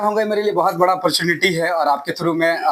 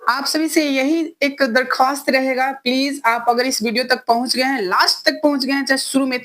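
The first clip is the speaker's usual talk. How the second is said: woman's voice; 5 semitones higher than usual. Pretend. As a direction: speak as a young woman. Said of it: "लड़की की आवाज में".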